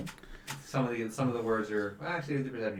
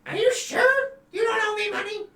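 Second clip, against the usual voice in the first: suspicious squeak; 9 dB louder than usual. Acted. NPC repeats suspicion.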